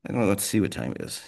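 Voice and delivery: usual speaking voice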